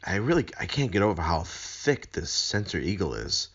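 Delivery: ordinary talk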